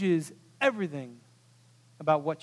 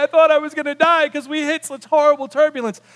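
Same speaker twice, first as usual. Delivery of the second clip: high, loud, hammed-up distress; mock-hysterical outburst, raised pitch; panicked passenger; 7 semitones higher, 7 dB louder than usual. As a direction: wail it like a terrified passenger. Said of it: "I crawl up in a ball".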